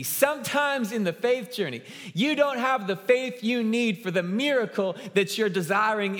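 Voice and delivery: high, loud preaching push; full-force preaching. Acted. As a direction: drive it home to the congregation. Preaching climax.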